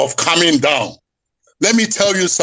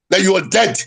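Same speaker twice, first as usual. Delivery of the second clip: ordinary delivery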